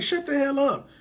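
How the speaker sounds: high-pitched no-way reaction; amazed disbelief. Retold or imitated. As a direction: react as if the news can't be true. Retold amazed reply to price.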